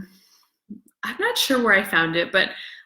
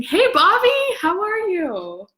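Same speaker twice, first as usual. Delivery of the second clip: higher, louder hey Bobby; excited greeting; 11 semitones higher, 3 dB louder than usual. Real, not acted. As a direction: call out to a friend who just arrived. Greets a viewer joining live.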